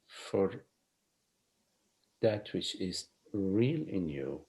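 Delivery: hushed, unhurried guiding voice; soft spiritual teaching. Acted.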